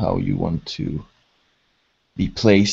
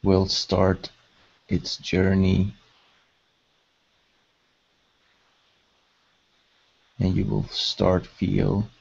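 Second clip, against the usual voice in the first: measured, level delivery, leaving space to breathe; guided meditation. Acted. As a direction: guide them gently into calm, phrase by unhurried phrase.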